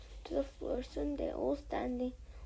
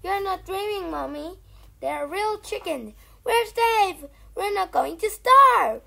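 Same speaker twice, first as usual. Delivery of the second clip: much higher, much louder, bursting with joy; overjoyed child; 6 semitones higher, 14 dB louder than usual. Acted. Small fox convincing his starving mother, high and loud. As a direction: shout the good news up high.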